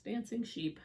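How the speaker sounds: ordinary delivery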